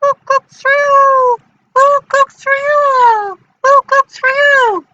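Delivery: high, loud owl hoot. Acted. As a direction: hoot it out like an owl calling from afar. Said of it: "using my owl voice. It kind of sounds like this".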